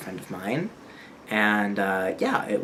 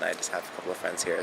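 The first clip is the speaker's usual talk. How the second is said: ordinary delivery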